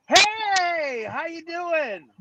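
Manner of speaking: high, slow, sing-song hello; a friendly shop owner's high, drawn-out hello; cheery greeting. Retold or imitated. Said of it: "this time it was like".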